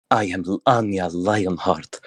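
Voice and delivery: slow breathy seductress, lightly done; breathily. A narrator's reading. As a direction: breathe the name out slowly. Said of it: "she says breathily".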